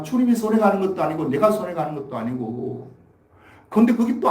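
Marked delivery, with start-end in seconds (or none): none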